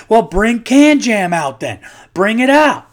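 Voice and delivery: high, loud cocky challenge; mock trash talk. Calling out challengers at a backyard party, loud and cocky. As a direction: hype up the backyard crowd. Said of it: "I'm like".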